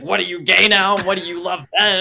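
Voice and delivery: imitating dad's loud, indignant questioning; angry dad. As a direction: explode like an angry father. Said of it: "he explodes. He gets angry".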